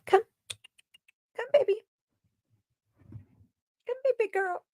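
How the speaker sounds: sweet high voice to lure the cat; coaxing the kitty in high tones; cat-calling voice. Real, not acted.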